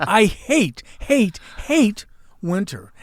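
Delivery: HATE hit hard and high each time; emphatic loathing. Real, not acted. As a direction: punch every "hate" with a pause after it. Climax of rant against winter.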